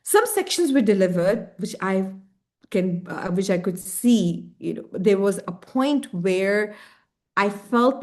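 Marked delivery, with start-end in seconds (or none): none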